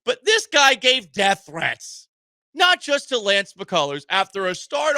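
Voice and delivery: indignant emphasis; emphatic. Real, not acted. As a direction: lean hard on death threats. Host ranting.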